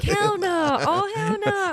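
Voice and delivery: put-on high, loud horrified girl voice; high-pitched girls. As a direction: squeal it like a freaked-out girl. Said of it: "Girls are like".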